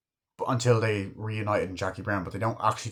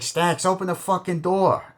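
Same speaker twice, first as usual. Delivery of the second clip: mock shout at door; 7 semitones higher, 4 dB louder than usual. Banging on Stax's door.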